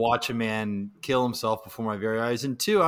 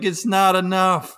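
imitated complaint; 6 semitones higher, 4 dB louder than usual. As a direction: demand more, unimpressed. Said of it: "was like".

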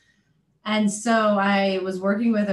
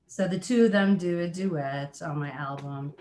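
ordinary talk, nothing marked

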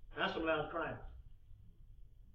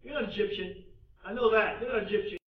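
acted rough interrogation, louder and higher; rough questioning; 5 semitones higher, 8 dB louder than usual. Joseph questioning his unknowing brothers as strangers, roughly. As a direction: challenge them roughly as suspect strangers. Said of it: "spoke roughly to them".

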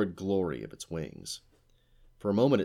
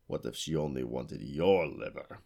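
lightly voiced demand; 4 semitones lower than usual. A narrator's reading. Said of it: "he demanded".

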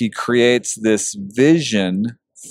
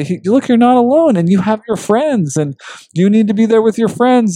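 higher, louder, voice welling up with feeling; choked-up emotion; 11 semitones higher, 3 dB louder than usual. Retold or imitated. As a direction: plead it as if about to cry. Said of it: "oh, I'm gonna get so emotional".

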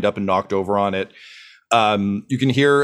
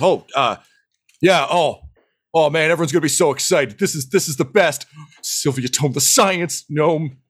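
starstruck dwarf; 4 semitones higher than usual. Acted.

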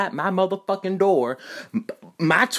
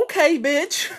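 laughing comeback; 13 semitones higher than usual. Retold or imitated. Stammered comeback, breaking into laughter.

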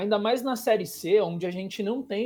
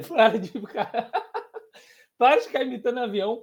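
laughing; 3 semitones higher, faster than usual. Real, not acted. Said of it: "laughing at friend's impression".

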